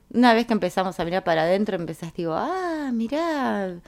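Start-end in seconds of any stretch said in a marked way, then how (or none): 2.3-3.8 high, drawn-out "ah"; mock dawning surprise. Someone looking inward and discovering something, acted surprise. Acting a discovery about oneself.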